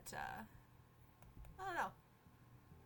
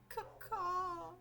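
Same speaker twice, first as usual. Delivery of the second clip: crow caw; 7 semitones higher, 3 dB louder than usual. Acted. Crow companion voiced in game.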